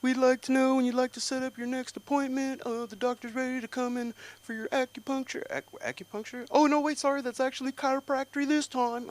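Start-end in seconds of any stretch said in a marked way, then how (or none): 0.0-5.4 high, polite office receptionist; secretary on the phone. Retold or imitated. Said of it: "I got a call from my doctor's office". 6.5-9.1 high, polite office receptionist; secretary on the phone. Retold or imitated. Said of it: "call from my doctor's office".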